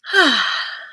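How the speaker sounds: high, falling sigh; a short, loud exhale dropping in pitch. Real, not acted. Host getting ready for the readings, loud sigh. Sighs while looking up readings.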